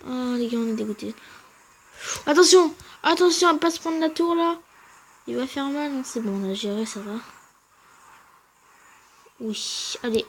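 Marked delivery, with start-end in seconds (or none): 2.3-4.6 loud, high alarm call; loud warning to the viewers about the tower; urgent warning. Real, not acted. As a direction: shout a warning to your team.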